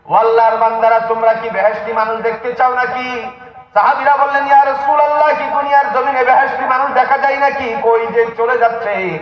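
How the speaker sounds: high, sustained sermon pitch; impassioned preaching. Acted. Preacher retelling Bedouin's plea and Prophet's answer, high impassioned preaching. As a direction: lift the voice high and preach the story.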